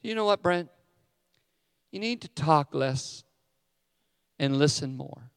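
a light, soft imitation of his wife; gentle wife voice. Pastor mimics his wife's kind correction softly. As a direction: voice her gently, nice about it. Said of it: "That's exactly how she would say it".

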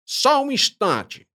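high, slow interrupting voice; drawn-out high voice of an otherworldly guide; Kid voice. Acted. Kid speaks, voiced figure.

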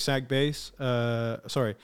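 ordinary delivery

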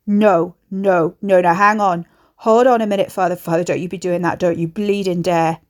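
loud, urgent, alarmed pleading; panicked protest. A narrator's reading. Son to father holding the soup, loud panicked protest. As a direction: burst out to stop him in alarm.

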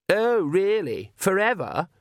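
high, slow mock question; scornful challenge. A narrator's reading. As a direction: throw it back high at the father. Daughter challenging her father.

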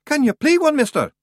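put-on a tiny man calling up to a boy; Feegle voice. A narrator's reading. Feegle asks Roland.

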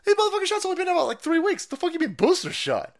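outraged disbelief; incredulous. Real, not acted. Incredulous rant.

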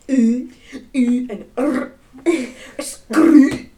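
loud rolled rr and drawn ooh, loud and high; demo of Scottish sounds. Shown as a demonstration. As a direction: belt out the ooh and roll the rr hard. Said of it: "it's the R's. And it's the oohs".

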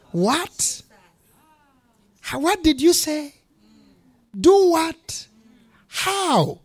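high, incredulous rising "what"; stunned disbelief. Retold or imitated. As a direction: act floored by an outrageous answer. Stunned by landlord's retort.

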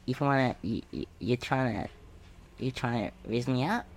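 lightly voiced girl elf. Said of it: "I'll be girl elf".

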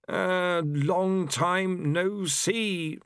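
high, drawn-out character voice; awkward greeting. A narrator's reading. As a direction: stretch out an uneasy hello, pitched high. Pestilence greets old comrade.

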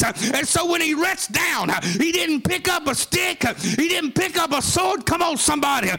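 shouted, rhythmic sermon climax at a high pitch; sing-song hollering preacher in full flight; full-force preaching. Acted. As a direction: preach it hard, whooping toward the crowd.

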